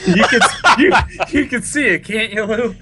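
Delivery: high, loud helpless laughter; laughing hard. Real, not acted. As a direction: talk through a fit of laughter. Cracking up over Palpatine.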